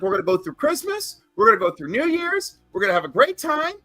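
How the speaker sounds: rally the family like nothing's wrong, quick and high; urgent rallying quote. Retold or imitated. Dying aunt reassuring family, retold.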